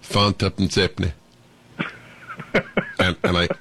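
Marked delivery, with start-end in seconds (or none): 0.0-1.1 lightly voiced Yorkshire "Fan tuppence halfpenny"; Yorkshire market trader. Leeds stallholder telling the young host the price, mild Yorkshire accent. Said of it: "And he said".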